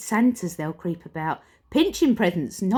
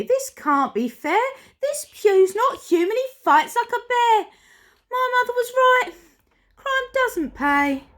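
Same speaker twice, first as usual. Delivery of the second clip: panicked robber; 4 dB louder than usual. Acted. Billy to his gang, high despairing wail. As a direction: lament like a beaten crook.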